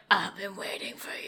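hushed, menacing movie-showdown whisper; soft, theatrical threat; mock ominous whisper. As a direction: whisper it like a hammed-up avenger. Voices Laurie's ominous showdown line.

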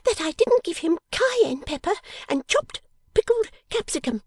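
high, loud little-dressmaker voice; very high, vehement character voice; fierce energy. Acted. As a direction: rap it out high and vengeful. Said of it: "rigid from head to foot with energy".

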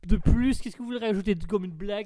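usual speaking voice

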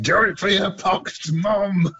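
high, slow hammed-up announcer voice; game announcer. Quoting a game announcer to a friend, slow hammed-up call. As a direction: declaim it like a rousing medieval general. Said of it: "Medieval two announcers".

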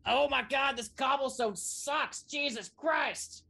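high, loud, slow complaining yell; yelling creature. Acted. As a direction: yell out in disgust at the road. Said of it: "a creature that yells out".

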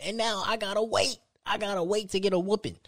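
high-pitched mock protest; exasperated kid's complaint. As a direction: complain like a child made to wait. Reliving kid's dread of delayed whooping.

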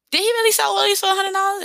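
high-pitched, quick disbelieving question; mock astonishment, pitched high; incredulous onlooker. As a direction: imitate someone amazed, voice shooting way up. Voicing an amazed onlooker's question.

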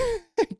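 a high, breathy little giggle. Real, not acted. Fan laughing at his own rant about Rippon, high giggle. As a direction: break into a high giggle at your own joke.

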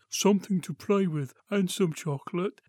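lightly voiced advert man; mock Kinder Egg advert voice; imitating Bernard Bresslaw. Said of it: "That sounded like Bernard Bresslaw".

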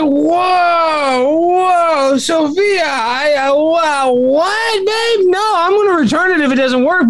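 exasperated retort. Retold or imitated. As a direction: yell back in disbelief at her overreaction. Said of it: "And I was like".

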